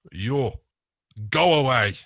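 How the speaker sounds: loud, sharp order to leave; loud dismissal. Acted. Igor orders the Minotaur away, loud and curt. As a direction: bark it at the Minotaur. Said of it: "and says".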